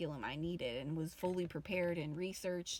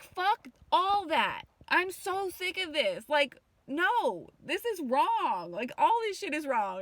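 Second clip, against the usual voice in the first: higher, louder and slower, fed up; fed-up outburst; 8 semitones higher, 6 dB louder, slower than usual. Retold or imitated. Venting to viewers about wearing masks, angry and loud. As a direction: blow up at the whole mask routine. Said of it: "And I'm like".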